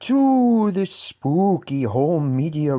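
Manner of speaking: slow, eerie, wavering ghost voice. Pretend. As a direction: moan it out like a spooky ghost. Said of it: "that ghostly voice said".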